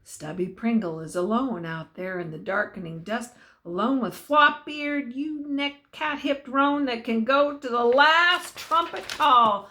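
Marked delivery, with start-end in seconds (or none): none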